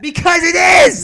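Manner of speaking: high, drawn-out yell of protest; loud, high, insistent outburst; exasperated shout. Real, not acted. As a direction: shout it back, stretching the words. Shouting back in heated argument.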